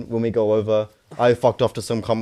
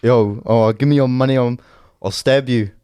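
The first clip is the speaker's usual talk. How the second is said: loud London roadman threat; mock street robber, loud; 5 dB louder than usual. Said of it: "I'm a roadman that's coming to rob you".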